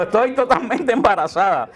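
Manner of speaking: "Estoy totalmente embarazada" slow, high, through a laugh; laughing while quoting. Retold or imitated. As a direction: quote the grandma while cracking up. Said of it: "vieja empezó a dar gritos cuando dijo". (citando entre risas, lento y agudo)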